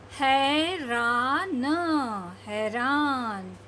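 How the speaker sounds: हैरान drawn out slowly, falling; slow reading. Shown as a demonstration. Teacher modelling slow reading for kids. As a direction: stretch हैरान slowly twice. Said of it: "धीरे धीरे पढ़ने की कोशिश करिए".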